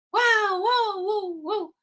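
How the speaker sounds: high yelping echo that fades; echo imitation. Imitating the mountain echo of a shout. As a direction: mimic the echo bouncing back.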